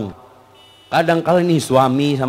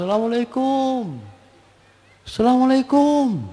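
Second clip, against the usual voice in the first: husband calling out; 9 semitones higher, 5 dB quieter than usual. Acted. Husband to household on coming home, calling out. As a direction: call the greeting into the house, stretched and high.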